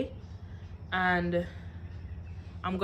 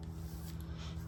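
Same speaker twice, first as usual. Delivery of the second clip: breathy laugh; 17 dB quieter than usual. Real, not acted. Laugh after retelling shock.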